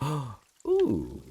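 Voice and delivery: surprised gasp. Real, not acted. Finding an egg in game.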